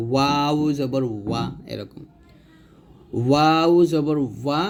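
ওয়া slow with lips rounded; Arabic waw demo. Shown as a demonstration. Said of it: "ঠোঁট গোল করে".

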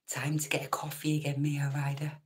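soft unvoiced whisper, barely breathed. Real, not acted. Streamer whispering to a sleepy viewer, hushed. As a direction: whisper it so the early riser isn't woken. Whispered aside about early morning.

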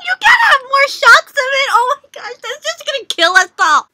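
high, loud shout at the screen; excited shout. Real, not acted. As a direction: yell it at the screen in mock outrage. Fan shouting at the show.

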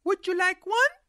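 put-on high girl's voice; little girl voice.

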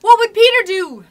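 high, loud call; excited cry. A narrator's reading. Lost boys seeking Peter's answer, urgent cry. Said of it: "the lost boys cried simultaneously".

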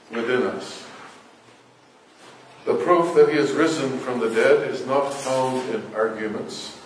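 ordinary delivery

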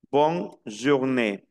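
Bonne journée drawn out, boŋ ʒuɡne; teacher modelling slowly; slow French demo. Shown as a demonstration. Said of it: "Miren que pronuncio esta E que tiene tilde".

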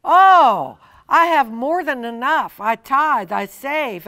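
bright, loud "Oh", then a confident boast; joyful declaration. Pretend. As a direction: proclaim abundance with delight. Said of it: "speak words of life and say".

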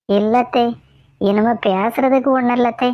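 slow, halting, drawn-out lament; slow mock sadness. Daughter-in-law slowly builds mock-tragic suspense to mother-in-law. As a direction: stretch each word as if confessing tragedy.

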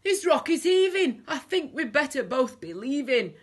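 high, loud worried crook; alarmed crying out. A narrator's reading. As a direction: cry it out in a panic. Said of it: "cried Hugh".

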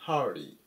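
はで始まるhurry; model pronunciation. Shown as a demonstration. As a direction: hurry read with は at the start. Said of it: "はっていうふうに読みます".

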